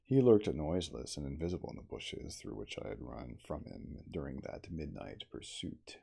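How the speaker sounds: usual speaking voice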